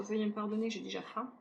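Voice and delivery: ordinary delivery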